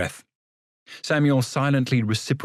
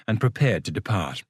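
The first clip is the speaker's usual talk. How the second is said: ordinary delivery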